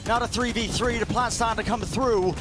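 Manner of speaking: high, loud hype play-by-play; esports caster. Acted. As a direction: call every move with rising hype. Live play-by-play climax.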